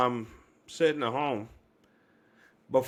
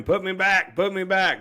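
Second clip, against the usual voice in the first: high, loud pleading cry; desperate loud plea; screaming; 7 semitones higher, 5 dB louder than usual. Retold or imitated. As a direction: yell it, begging to be sent back. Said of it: "I began screaming".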